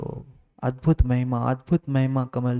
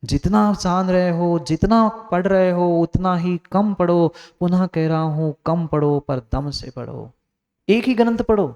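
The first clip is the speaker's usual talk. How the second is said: raised, forceful sermon voice; emphatic preaching; 6 semitones higher, 5 dB louder than usual. Acted. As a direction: drive the instruction home to the congregation. (ऊँचे स्वर में ज़ोरदार उपदेश)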